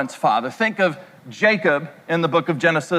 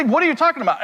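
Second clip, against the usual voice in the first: higher mock-baffled dad; imitating his dad; 7 semitones higher, much faster than usual. Preacher voicing his dad's puzzled reply, higher. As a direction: toss it off like a puzzled father. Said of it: "He would say".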